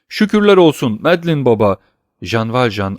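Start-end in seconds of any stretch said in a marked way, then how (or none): 0.1-1.8 higher, louder cry of thanks; narrated joyful shout. A narrator's reading. Old man finding Madeline alive after the fright, crying out in relief. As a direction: cry out your relief, pitched up. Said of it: "Sonra ayağa kalkıp bağırdı".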